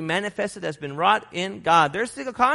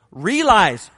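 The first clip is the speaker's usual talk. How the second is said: louder, punch the single word; emphatic call; 7 dB louder than usual. Real, not acted. Preacher calling the congregation to realize. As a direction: strike it like a bell.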